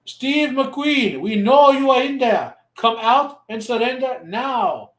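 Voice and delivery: put-on high, loud, slow Spanish-accented English; mock Spanish accent. Soldiers outside the bar order McQueen out, hammed-up Spanish accent. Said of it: "like, Spanish accent and English said".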